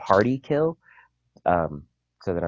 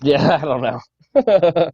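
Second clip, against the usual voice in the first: laughing through the words; giggling helplessly while talking; 10 semitones higher, 12 dB louder, much slower than usual. Real, not acted. Laughing after failed noise.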